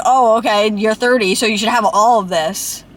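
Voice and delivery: usual speaking voice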